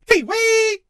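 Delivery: high, loud cry; excited shout. Acted. Crowd cheering for their drug, high yell. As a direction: belt it out like a chant.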